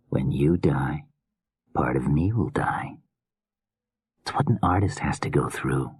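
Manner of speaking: soft creepy murmuring killer; hushed, unsettling madman musing; menacing whispery killer. Acted.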